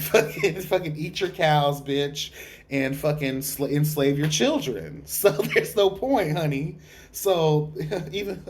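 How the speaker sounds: ordinary talk, nothing marked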